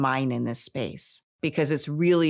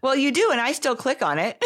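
laughing; 10 semitones higher than usual. Real, not acted. Laughing while teasing the host.

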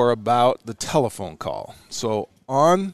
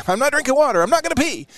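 put-on much higher, petulant kid voice; high childish whine; 14 semitones higher, faster than usual. Continues mock refusal, very high.

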